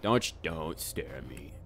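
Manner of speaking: ordinary talk, nothing marked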